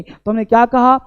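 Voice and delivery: ordinary talk, nothing marked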